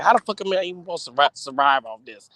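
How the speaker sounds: ordinary talk, nothing marked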